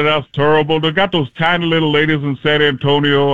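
put-on voice, altered timbre; Charles Barkley. Comedian mocking ladies of San Antonio, sportscaster impression. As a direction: grumble it as an impression. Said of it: "the Charles Barkley".

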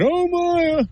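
very high mock-horrified squeal; exaggerated falsetto dismay. Analyst mocks a player dreading the trade, mocking. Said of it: "you were kind of mocking it".

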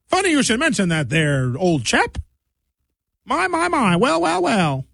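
put-on high, old-fashioned chap voice; mocking Reggie's old-fashioned comments; old-timey gentleman. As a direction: talk like someone from nineteen thirty-one. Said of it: "That's how he talks".

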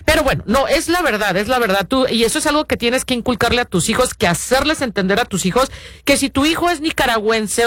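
ordinary talk, nothing marked

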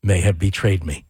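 low, slow, creepy delivery. Pretend. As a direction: read it like a horror villain. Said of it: "in that creepy voice".

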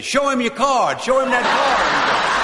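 high, loud holler across the yard; gleeful shouted comeback, stretched at the end; mocking call-out. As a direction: yell the punchline back at him. Friend calls mocking advice to bureaucrat.

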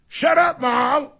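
screamed at full force; bratty kid screaming at mom; shrieking shut up, Mom; kid yelling. Retold or imitated. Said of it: "the child goes".

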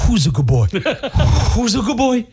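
put-on high sing-song baby talk; baby talk to dog. Host telling listeners to coo into the sewer, high baby talk. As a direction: coo it like praising a puppy, high. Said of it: "go to the sewer and say".

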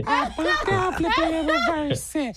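lightly imitating [name], high and laughing; a playful hint of a [name] rap. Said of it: "You're not [name]".

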